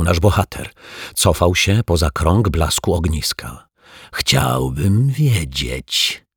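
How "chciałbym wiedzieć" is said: slow, lower rasping stranger; a sinister magician rasps slowly at the detective; rasped. A narrator's reading. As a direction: drag the words out in a menacing rasp. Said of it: "wychrypiał".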